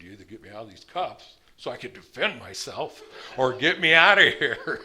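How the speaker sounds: rising to a loud shout at the end; retold yell at the cop. Cuffed man yelling at the cop to get him out, building to a shout.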